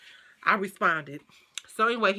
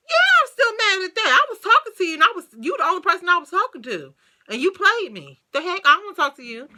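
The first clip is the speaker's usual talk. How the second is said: much higher and louder, indignant; heated retort; 10 semitones higher, 7 dB louder than usual. Retold or imitated. Telling the ex she is still mad: high, loud, indignant retort. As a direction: let him have it. Retelling her angry reply to him.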